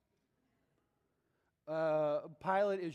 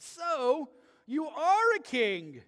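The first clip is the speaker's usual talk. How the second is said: slow, higher, louder sneer; sarcastic disbelief; 6 semitones higher, 8 dB louder, much slower than usual. Retold or imitated. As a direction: drag it out as if the claim is absurd. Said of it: "it's emphatic and sarcastic".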